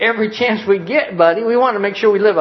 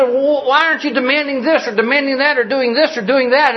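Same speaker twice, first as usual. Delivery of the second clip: upset sister; 8 semitones higher than usual. Retold or imitated. Upset sister challenges him, retold.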